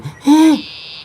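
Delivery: a sudden high gasp of alarm; mock shocked gasp. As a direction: gasp as if the TV just crashed and the beer spilled. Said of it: "and you go".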